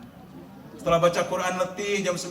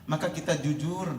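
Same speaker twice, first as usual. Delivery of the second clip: ordinary talk, nothing marked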